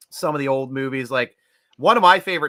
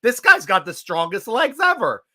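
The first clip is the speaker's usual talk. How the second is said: excited; 9 semitones higher, 6 dB louder than usual. Retold or imitated. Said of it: "And I'm like".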